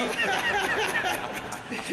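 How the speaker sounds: high breathy laugh mid-sentence; embarrassed laugh. Real, not acted. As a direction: laugh it off sheepishly. Laughs mid-sentence after coughing.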